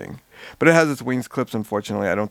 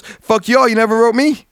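higher, louder pretend comeback; mock indignant retort; 9 semitones higher, 5 dB louder than usual. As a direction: toss it back in mock outrage. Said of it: "and be like".

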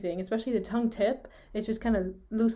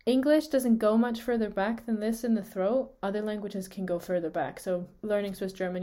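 ordinary delivery

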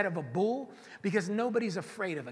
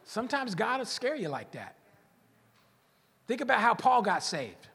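ordinary talk, nothing marked

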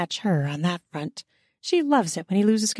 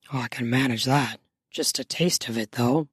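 low male voice; 8 semitones lower than usual. A narrator's reading. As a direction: lower voiced man, teasing. Male character voice.

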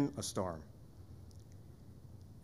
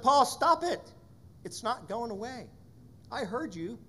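the Lord's reply; 9 semitones higher than usual. Retold or imitated. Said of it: "the Lord finally said".

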